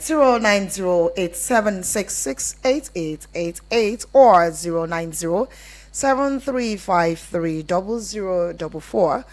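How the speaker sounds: ordinary talk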